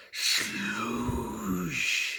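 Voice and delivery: soft, breathy splashing sound effect. A narrator's reading. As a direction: make the muddy splash.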